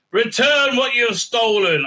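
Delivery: high, declaimed eerie-message parody; mock weird woman. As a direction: proclaim it as a strange woman's demand. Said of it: "this weird woman says".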